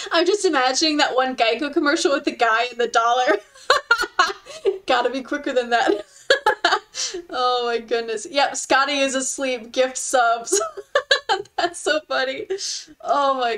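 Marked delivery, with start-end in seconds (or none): 0.0-8.3 talking through real giggles; cracking up. Real, not acted. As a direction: laugh between phrases at your own joke. Cracking up at a remembered commercial.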